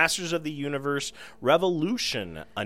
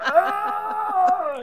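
burning scream; 4 dB louder than usual. Retold or imitated. Moss Man set on fire.

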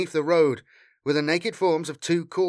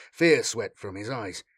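ordinary delivery, nothing marked

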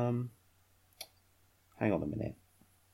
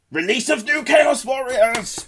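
high booming herald call; grand announcement; 13 semitones higher, 4 dB louder than usual. Acted. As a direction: announce it like a fanfare. Dramatic announcement of release.

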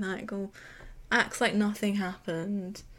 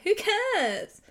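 Who cares tossed off much higher and louder; mock dismissive dad; 12 semitones higher, 4 dB louder than usual. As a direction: scoff it like a snobby rich father.

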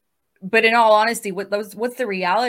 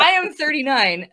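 laughing; 7 semitones higher, 5 dB louder than usual. Real, not acted.

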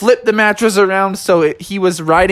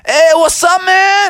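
Iggy in a high, louder surfer voice; high excited buddy; 5 dB louder than usual. Acted. Iggy greeting his friend on the slope, high and stoked. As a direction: greet him like a stoked snowboarder.

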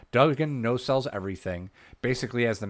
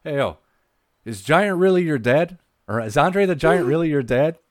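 higher, louder imitation of a needling wrestler; Scott Hall; 6 semitones higher, 7 dB louder than usual. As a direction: play a wrestler calling out a nosy question. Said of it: "Scott Hall turns to the Giant and goes".

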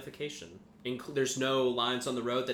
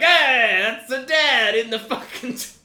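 loud, high, hammed-up kid holler; Italian worker's kids; 12 semitones higher, 14 dB louder than usual. Worker's kids spotting dad on screen, loud mock holler. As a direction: yell it as a caricature Italian family. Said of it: "his kids are like".

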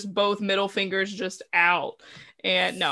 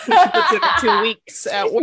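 teasing exclaim; 8 semitones higher, 7 dB louder than usual. Acted. Teasing, higher and louder.

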